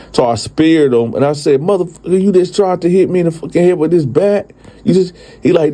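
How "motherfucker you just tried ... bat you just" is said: high, heated demand; high, heated outburst at the attacker; angry yell. Retold or imitated. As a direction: confront him, furious.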